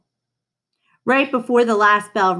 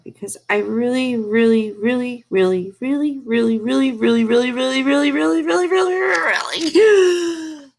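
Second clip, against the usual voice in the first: really repeated, climbing higher and louder to a shout; building plea; 5 semitones higher, 5 dB louder than usual. A narrator's reading. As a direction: build each really up until it bursts out. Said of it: "Lily said to Mister Slinger".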